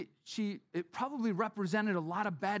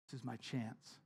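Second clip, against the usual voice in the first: hushed hope; 4 semitones lower, 10 dB quieter than usual. Retold or imitated. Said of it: "is like".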